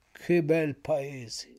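soft drawn-out longing; mouth-watering longing. A narrator's reading. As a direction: savour it softly, drawing each word out. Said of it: "sentendo venirsi l'acquolina in bocca".

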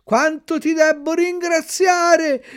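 high, slow, grateful puppet voice. A narrator's reading. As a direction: Pinocchio thanking the dog that saved him. Pinocchio thanks the dog. (burattino riconoscente con voce acuta)

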